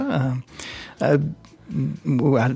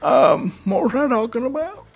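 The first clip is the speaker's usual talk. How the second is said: put-on old grandpa voice; 10 semitones higher than usual. Deaf grandpa character.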